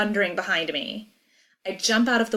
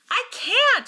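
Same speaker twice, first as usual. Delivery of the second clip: high, panicked shout; desperate high yell. A narrator's reading. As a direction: shout it helplessly, voice way up. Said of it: "she shouts".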